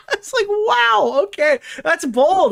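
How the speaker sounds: high, slow, sarcastic wow; mock amazement. Real, not acted. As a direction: gush over it in fake awe, drawn out. Sarcastic amazement at bad plot.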